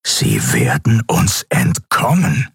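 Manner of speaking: slow, drawn-out villainous growl; seething. A narrator's reading. Captain claws the screen as the ship escapes, seething. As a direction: draw out each word in frustration.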